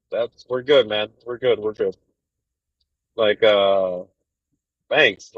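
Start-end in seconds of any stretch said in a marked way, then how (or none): none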